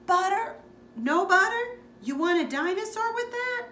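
high, loud vendor's cry; popcorn man shouting. Acted. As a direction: call it out across the theater lobby. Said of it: "the popcorn man shouting".

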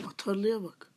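usual speaking voice

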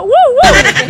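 slow high woofs with a laugh; dog bark imitation. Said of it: "¿cómo ladra la perra de tu casa?".

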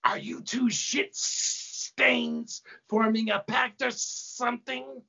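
high, slow hissing snake woman; slithery high-pitched sneer; snake-faced demon. A narrator's reading. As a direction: hiss the S sounds long and suspiciously. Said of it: "Slithotheus looks at Maychonin".

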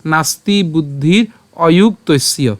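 slow, distinct নাস্তি বুদ্ধির অযুক্তস্য; demonstrated Sanskrit pronunciation. Shown as a demonstration. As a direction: draw out each syllable of নাস্তি বুদ্ধির অযুক্তস্য. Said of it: "উচ্চারণটা কেমন হচ্ছে". (নাস্তি বুদ্ধির অযুক্তস্য ধীরে স্পষ্ট উচ্চারণে)

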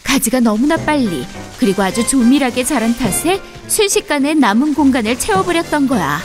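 ordinary delivery

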